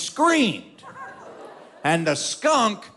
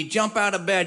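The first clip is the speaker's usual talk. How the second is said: ordinary delivery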